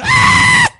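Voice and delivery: high, very loud scream; piercing imitation shriek; terrified cry. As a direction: cry out loud in mock terror. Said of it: "I just know they cried out".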